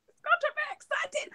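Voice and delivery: high, cracking excited cry; excited squeal. Real, not acted. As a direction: squeal it out like a fan. Hyping the TV show.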